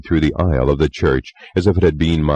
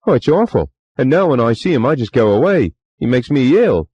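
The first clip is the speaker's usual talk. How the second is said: higher, faster, louder servant voice; chatty young bellboy, eager to please; Cockney bellboy; 10 semitones higher, 4 dB louder than usual. A narrator's reading.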